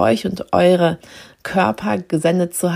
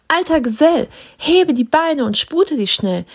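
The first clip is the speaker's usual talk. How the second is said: bright calling voice; 7 semitones higher than usual. A narrator's reading. Christkind hurrying Knecht Ruprecht, bright higher call. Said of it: "rief's mich mit heller Stimme an".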